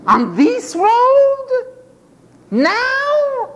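high, slow, drawn-out disbelief; astonished question. Acted. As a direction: stretch it high in wonder. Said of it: "beggars hear Jesus passes".

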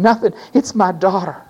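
ordinary talk, nothing marked

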